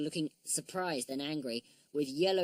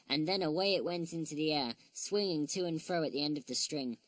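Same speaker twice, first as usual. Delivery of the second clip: usual speaking voice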